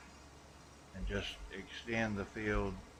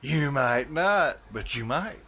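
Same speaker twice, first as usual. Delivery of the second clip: teasing; 4 semitones higher, 7 dB louder, slower than usual. Acted. Playful teasing aside.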